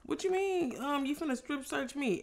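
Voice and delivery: retold irritated pushback at the search; irritated disbelief. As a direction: replay her annoyed what-do-you-mean. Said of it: "irritated, like".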